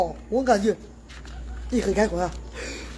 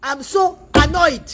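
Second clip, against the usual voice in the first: drags out each word in English, slowly; slow annoyed English; 4 semitones higher, much slower than usual. Acted. Queen announcing her anger to the audience, slow and annoyed. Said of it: "la reine Galoise est fâchée".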